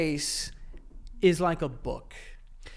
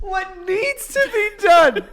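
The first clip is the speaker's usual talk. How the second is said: mock villain voice; 13 semitones higher, 9 dB louder, much slower than usual. Mocking partner's menacing line.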